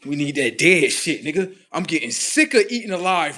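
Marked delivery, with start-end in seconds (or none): none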